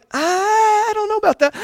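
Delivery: high, doubtful whine; disbelieving reply to the forecast; skeptical doubt. Retold or imitated. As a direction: raise a sceptical eyebrow in the voice. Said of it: "And I'm like".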